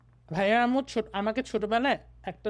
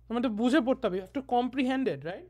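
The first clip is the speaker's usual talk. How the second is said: ordinary talk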